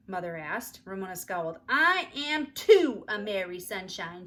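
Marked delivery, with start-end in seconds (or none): 1.7-4.2 put-on loud, slow, stubborn child shout; angry shout. A narrator's reading. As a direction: yell it defiantly. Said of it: "she shouted angrily".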